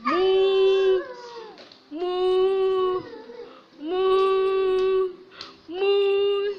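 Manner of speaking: long, level held moos; cow mooing. Acted. Adult shows child how cow sounds, long mooing. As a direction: moo like a cow, stretching each one. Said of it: "How, how cow make noise?".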